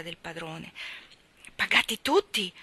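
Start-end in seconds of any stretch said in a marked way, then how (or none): 1.5-2.5 louder, startled question; father agitated at hearing the news. A narrator's reading. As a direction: snap up the words in alarm. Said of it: "domandò con viva agitazione".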